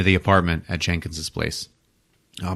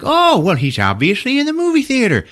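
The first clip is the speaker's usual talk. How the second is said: much higher, breezy mock-obvious voice; deadpan realisation; 14 semitones higher, 3 dB louder than usual. Amelie spots the bloody claw print, high mock-obvious. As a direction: state the obvious in a bright higher voice. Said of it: "She's like".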